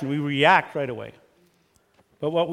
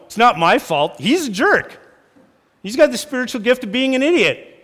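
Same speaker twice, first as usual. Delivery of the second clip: higher, louder petulant blaming; mock defensive spouse; 8 semitones higher, 6 dB louder than usual. Defensive spouse insulting husband, high petulant mock. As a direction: call him a jerk to dodge blame. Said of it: "You're saying".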